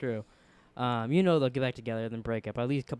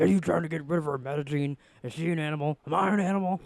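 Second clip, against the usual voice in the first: hammed-up angry impression, building at the end; half-serious yelled accusation, rising in pitch; mock yell. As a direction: badly imitate an outraged man yelling. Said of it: "Jefferson yells at Lynn".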